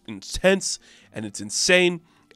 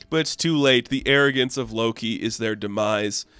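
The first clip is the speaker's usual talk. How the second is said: ordinary talk, nothing marked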